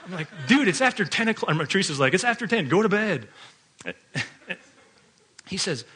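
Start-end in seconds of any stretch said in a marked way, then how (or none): 0.5-1.5 high, loud fed-up "dude"; mock annoyed scold. Dad telling his son off late at night, annoyed and loud. 2.1-3.3 mock stern mom. Wife scolding son.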